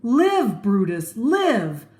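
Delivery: high, loud shout from the crowd; crowd call. Acted. A voice in the crowd shouting to Brutus, loud. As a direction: call out to stop him. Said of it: "A voice from the crowd called out".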